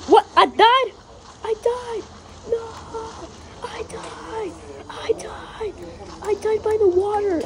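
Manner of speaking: high, stunned outcry; shocked disbelief. Real, not acted. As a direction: react to dying out of nowhere. Shocked at sudden death.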